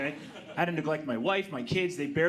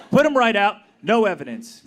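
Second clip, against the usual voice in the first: punchline shout; 4 semitones higher, 12 dB louder than usual. Acted.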